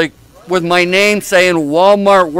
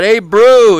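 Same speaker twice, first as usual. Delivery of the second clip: louder, higher mock hail; imitated yell; 5 semitones higher, 6 dB louder than usual. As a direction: imitate someone yelling out a greeting. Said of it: "yells out".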